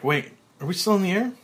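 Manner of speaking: high, loud, fast startled question; sudden alarm. A narrator's reading. Freezes in mid-spin.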